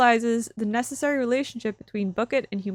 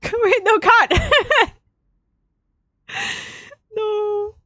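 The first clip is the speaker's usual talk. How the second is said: high, loud, laughing cut; laughing protest; 10 semitones higher, 4 dB louder, much slower than usual. Real, not acted. Streamer laughs at a bad result, high call of cut.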